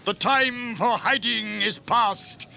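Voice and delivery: loud, high, slow rally; rallying call. Acted. King Theoden rallies his men to ride out, loud. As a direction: rouse the riders.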